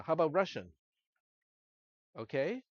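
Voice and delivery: ordinary delivery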